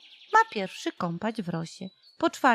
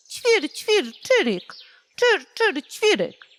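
high, loud indignant chirping; a cross little bird scolding; chirping sparrow; 8 semitones higher, 4 dB louder than usual. A narrator's reading. The sparrow scolds the showy nightingale, loud and high. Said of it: "Na to wróbel zaterlikał".

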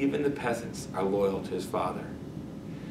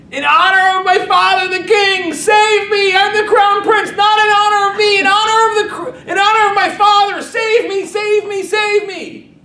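screamed far higher and louder; desperate scream; 12 dB louder than usual. Acted. Beaten crown prince crying out to be rescued, desperate scream. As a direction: beg for your life at the top of your lungs. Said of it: "he screams out".

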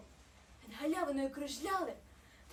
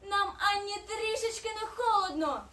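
high, loud, boasting snowflake; playful high call; merry snowflake; 4 semitones higher, 12 dB louder, slower than usual. A narrator's reading. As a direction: show off loudly, stretching out the last word. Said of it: "засміялася сніжинка".

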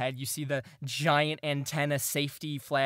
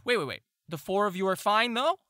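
gnome's worried check; 7 semitones higher, 4 dB louder than usual. Acted. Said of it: "Ozgul looks up and goes".

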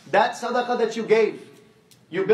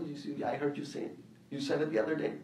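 softer, a hint of a small boy's voice; lightly voiced child; 6 semitones lower, 10 dB quieter, faster than usual. Son telling his father where he heard it, lightly childlike. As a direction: answer innocently, like a little kid.

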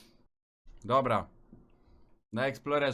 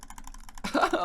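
unvoiced wheezing laugh; breathy laugh. Real, not acted. Laughs at own failure. (zdyszany śmiech)

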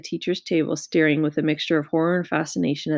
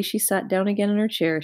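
plain reading voice

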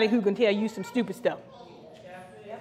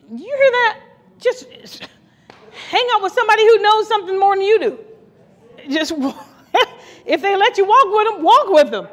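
higher, louder, fast exhortation; preaching at full force, higher and louder; excited preaching; 8 semitones higher, 6 dB louder than usual. Real, not acted. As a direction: lift it up and push the point home. Preacher building up.

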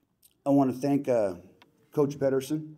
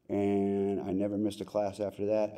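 ordinary talk, nothing marked

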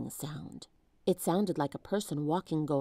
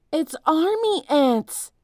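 slow, louder, higher alarmed cry; 9 semitones higher, 7 dB louder, slower than usual. A narrator's reading. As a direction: shout the danger, scared. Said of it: "cried Jack".

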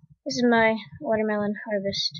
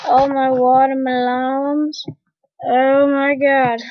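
usual speaking voice

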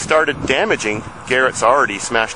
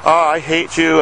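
mild, high-pitched mock scream of rage; imitated tantrum; 6 semitones higher than usual. Retelling a boy's fit over his smashed Tempo, mock enraged. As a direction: imitate a raging tantrum at raised pitch, without full volume. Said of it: "screaming in rage".

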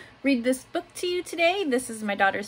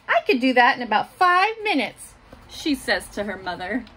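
put-on gentle picture-book reading for little kids; reading to children; 4 dB louder than usual. A narrator's reading. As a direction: read it like story time in class. Teacher reads picture book aloud.

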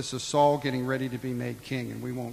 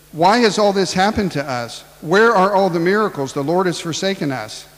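higher, loud plaintive whine; complaining Gideon; 5 semitones higher, 7 dB louder than usual. Acted. Gideon complaining to the Lord, high plaintive whine. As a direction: whine at God, feeling abandoned.

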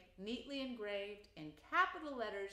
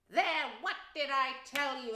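higher, louder triumphant crow; in great triumph; 5 semitones higher, 6 dB louder than usual. A narrator's reading. Rat shows mole the doormat, triumphant. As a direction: crow over the find. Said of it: "exclaimed the rat in great triumph".

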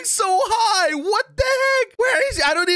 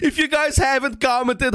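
ordinary talk

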